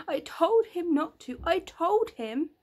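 high, loud groan of regret.

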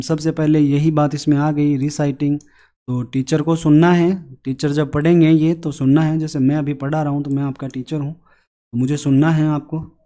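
usual speaking voice

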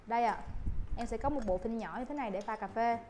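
ordinary talk

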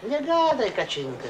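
high-pitched protest at being scolded. Acted. Man caught by his wife with friends, high-pitched protest. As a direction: raise the pitch sharply to cut her off. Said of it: "Ten jeszcze na mnie gębę drze".

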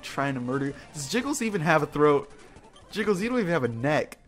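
normal talking voice

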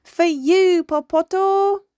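high, loud, stretched-out call; joyful yell. A narrator's reading. Young dolphin calls to his faraway cousin, yelling. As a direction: holler it across the water. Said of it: "little Hector yelled".

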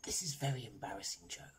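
voiceless, very soft confession; hushed and confidential; embarrassed whisper. A narrator's reading. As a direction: whisper it awkwardly. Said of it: "whispered Raj".

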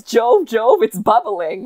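loud incredulous requote; mock shout. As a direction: repeat the cry in disbelief. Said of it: "What is".

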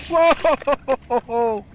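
ordinary delivery

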